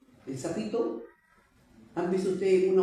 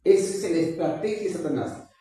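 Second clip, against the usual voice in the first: usual speaking voice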